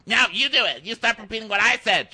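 high, loud comeback; imitating a heated childish argument; mock squabbling retort. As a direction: throw it back like a squabbling kid.

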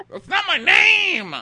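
high, loud, slow laughing shriek; mock shriek. Friend mocking contestant yelling about his name, in a high laughing shriek. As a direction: wail it out through laughter.

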